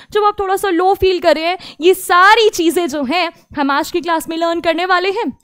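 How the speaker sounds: ordinary delivery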